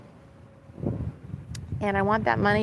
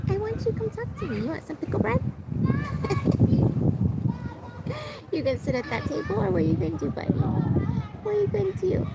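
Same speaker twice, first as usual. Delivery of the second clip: much higher, soft cooing pet voice; 14 semitones higher, 3 dB quieter, faster than usual. Real, not acted. To her pet bird, urging it closer, high cooing baby talk.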